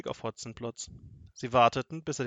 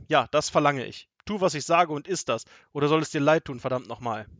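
loud, forceful bullying order; angry threat; 2 semitones higher, 8 dB louder than usual. A narrator's reading. Robber forces Seppel to eat soup, angry threat. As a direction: threaten him into obeying at once.

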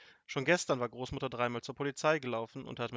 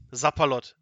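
loud burst of astonishment; punchy, amazed outburst; surprised exclamation; 11 dB louder than usual. A narrator's reading. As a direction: blurt it out, taken aback. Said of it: "rief der Wachtmeister überrascht".

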